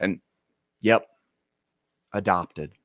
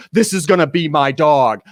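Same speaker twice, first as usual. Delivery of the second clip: pushier, louder repeat of the brag; louder boasting stranger; 3 semitones higher, 7 dB louder than usual. Retold or imitated. Stranger insists again to passersby, louder mock brag. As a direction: press the claim harder, louder.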